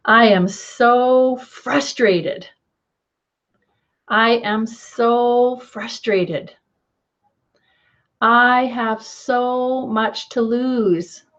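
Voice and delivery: slow, drawn-out frustrated tapping phrases; exaggerated frustration. Shown as a demonstration. Guiding viewers through tapping, slowly voicing exaggerated frustration. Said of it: "try to say it and exaggerate it".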